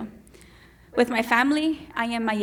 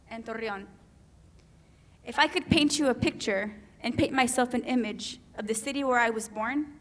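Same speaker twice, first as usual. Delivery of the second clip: usual speaking voice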